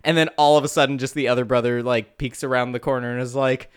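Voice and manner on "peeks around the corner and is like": ordinary talk, nothing marked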